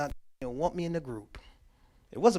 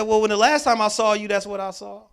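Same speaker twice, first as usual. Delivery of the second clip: loud, higher challenge; retold pointed comeback; 4 semitones higher, 7 dB louder than usual. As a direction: throw his past back at him.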